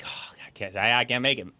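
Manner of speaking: hushed, faltering mock apology; imitated sadness. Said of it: "No, he sounded sad".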